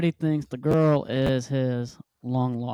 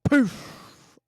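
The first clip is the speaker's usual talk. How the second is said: mock poof sound; 9 semitones higher than usual. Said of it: "Brendan Fraser is like".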